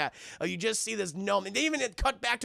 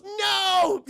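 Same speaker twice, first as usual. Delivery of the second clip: loud, very high gleeful shout; excited shout; 12 semitones higher, 6 dB louder than usual. Retold or imitated. Mabel turns down a doll for her hook, excited shout. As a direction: shriek the refusal with delight. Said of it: "she's so excited".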